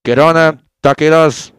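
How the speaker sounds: loud put-on Spanish accent; loud mock Spanish. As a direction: shout brand names in a hammed accent. Listing Mexican words in accent.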